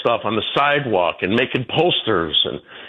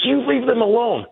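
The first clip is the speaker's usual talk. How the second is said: high pitched imitation of her snapping back; mock protester; 12 semitones higher than usual. Speaker imitates protester defending Iran, pitched high. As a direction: mimic her indignant retort. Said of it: "She goes".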